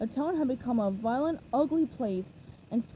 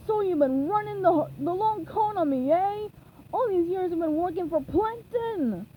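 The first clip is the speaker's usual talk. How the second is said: higher, louder, indignant accusation; betrayed anger; 6 semitones higher, 5 dB louder than usual. A narrator's reading. Mr. Krabs yells at the escaping SpongeBob, loud indignant accusation. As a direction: shake your fist as you accuse.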